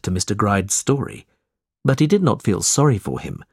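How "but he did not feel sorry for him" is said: ordinary delivery, nothing marked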